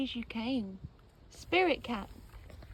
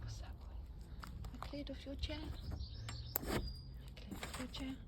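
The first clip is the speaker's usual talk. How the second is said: soft slow whisper; 2 semitones higher, 12 dB quieter, much slower than usual. Real, not acted. Whispering to her cat about his chair. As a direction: hush it to the cat.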